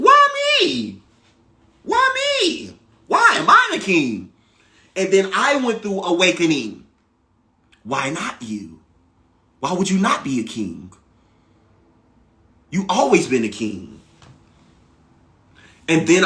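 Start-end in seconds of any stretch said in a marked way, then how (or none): 0.0-4.3 high, disbelieving cry of why me; squeaky high mock disbelief; incredulous why me. Asking why him, in disbelief. 7.8-14.0 low, soft, reassuring answer; hushed, steady reassurance; calm inner voice. Retold or imitated.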